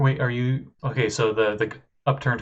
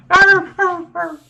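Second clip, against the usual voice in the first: high snarling hyena growls; 9 dB louder, slower than usual. Acted. GM voices giant hyena.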